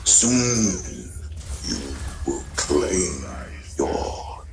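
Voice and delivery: low slow ominous orc warlock, breathing the end; Gul'dan voice. Acted. Voicing Gul'dan for the stream, slow menacing growl. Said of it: "what a voice".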